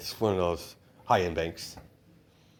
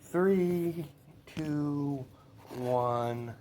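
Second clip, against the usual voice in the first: high, spaced-out countdown, fading softer; dramatic countdown; 6 semitones higher, 4 dB quieter, much slower than usual. Acted. Izuku counts down the raid.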